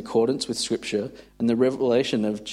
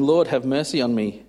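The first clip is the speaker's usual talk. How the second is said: ordinary delivery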